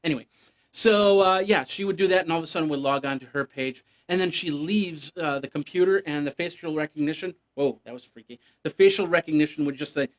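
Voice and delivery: ordinary delivery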